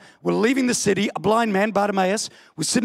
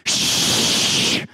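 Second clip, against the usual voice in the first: a breathy shush; mock shush. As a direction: hush him sharply. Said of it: "they come over and they go".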